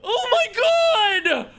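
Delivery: drawn-out, shouted victory cry; triumphant shout. Real, not acted. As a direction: crow it like you just won. Prediction proven right.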